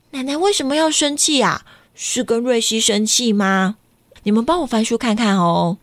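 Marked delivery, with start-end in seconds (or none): none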